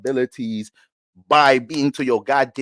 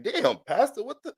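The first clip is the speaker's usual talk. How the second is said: startled churchgoer; 10 semitones higher than usual. Acted.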